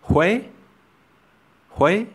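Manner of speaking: Huế said high with a W glide; Vietnamese word demo. Shown as a demonstration. Teacher models Huế for learners, high with a W glide. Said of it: "For example".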